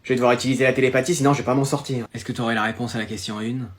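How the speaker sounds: low, hushed inner voice; secret telepathy. Acted. Twin asking brother during exam, hushed telepathy. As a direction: send it silently, soft and deep.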